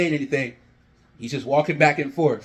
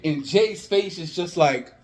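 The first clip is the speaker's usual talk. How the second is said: usual speaking voice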